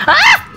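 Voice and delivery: very high, loud sudden shriek; a quick yelp of fright; shrill startled scream. Real, not acted. Gamer spooked mid-chat, shrill shriek.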